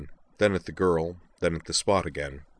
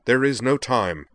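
loud, higher, urgent cut-in; barked; 6 semitones higher, 6 dB louder than usual. A narrator's reading. Mage cuts the man off, barking. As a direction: bark it, brooking no interruption. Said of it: "he barked".

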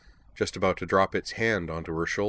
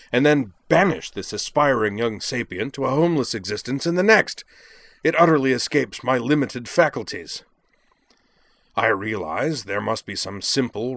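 emphatic, oratorical indignation; declaiming; 3 semitones higher, 4 dB louder than usual. A narrator's reading. Jakron protests an eviction to the dean, declaiming. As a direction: hit key words hard in a grand protest. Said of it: "declaiming to a rather bemused-looking Dean".

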